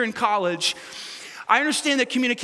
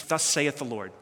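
solemn decree; 8 semitones lower, 6 dB quieter than usual. Acted. Solemn prophetic pronouncement voice.

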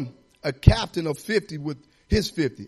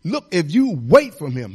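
ordinary talk

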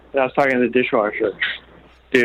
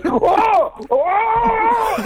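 an over-the-top thrilled gasp; excited whoa. Retold or imitated. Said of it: "and he goes".

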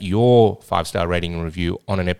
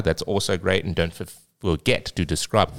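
usual speaking voice

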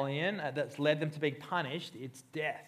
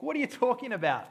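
incredulous; 8 semitones higher, 3 dB louder, faster than usual. Retold or imitated.

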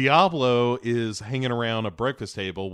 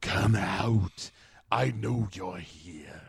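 low, slow, eerie mock witch; hammed-up spooky sorceress; Enchantress voice; 4 semitones lower, slower than usual. Podcaster imitating Enchantress luring during her dance, low and eerie. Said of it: "crazy dance as she's like".

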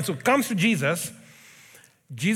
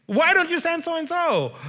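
whining son; 8 semitones higher than usual. Retold or imitated. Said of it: "me telling my son".

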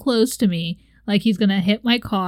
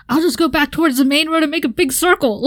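fast, higher voice of a bright idea; eager plan; 6 semitones higher, 4 dB louder, faster than usual. Retold or imitated. Retelling her escape plan to her cohost, eager and quick. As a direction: rattle off the clever escape plan. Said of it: "Then I was like".

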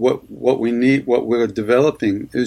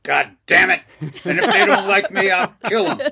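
mock angry tough guy; 8 semitones higher than usual. Voicing a forceful would-be nice guy.

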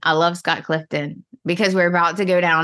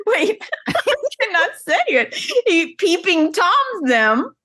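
words through real laughter; can't get it out for laughing; laughing at her own slip; 11 semitones higher than usual. Real, not acted. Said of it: "laughs Wait".

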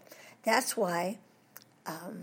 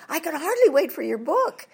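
eager fan; 7 semitones higher, 4 dB louder than usual. Retold or imitated. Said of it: "people who come to me".

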